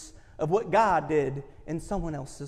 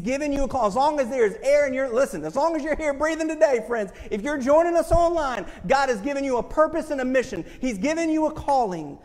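preaching at full force; 5 semitones higher than usual. Real, not acted. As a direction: lift it up and proclaim. Preaching climax.

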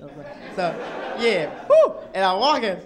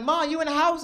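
calling out; 10 semitones higher, 5 dB louder than usual. Retold or imitated. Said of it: "I'm like".

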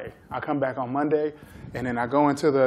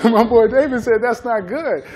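laughing repeat; 8 semitones higher, 9 dB louder than usual. Retold or imitated. Repeats the players' line, cracking up.

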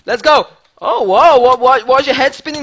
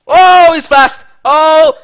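loud excited yell; excited shout; 2 semitones higher, 6 dB louder, much slower than usual. Real, not acted. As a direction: blurt it out loudly. Sudden excitement in fight.

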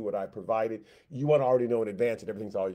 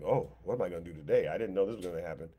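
clueless God; 5 semitones lower, much faster than usual. Retold or imitated. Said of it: "God's waking up and going".

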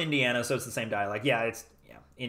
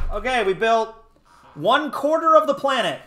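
loud, raised, celebrating voice; triumphant announcement; 9 semitones higher, 7 dB louder than usual. Real, not acted. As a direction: proclaim it proudly, pitched high and loud. Celebrates the milestone of the build.

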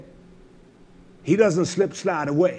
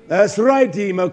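ordinary talk, nothing marked

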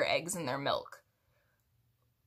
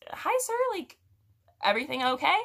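customer service voice; 7 semitones higher, 3 dB louder than usual. Retold or imitated. Cashier greets demanding customer.

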